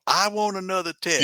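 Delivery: high-pitched mock protest; indignant guest. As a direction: imitate a guest demanding a retest. Mimicking guests disputing DNA results.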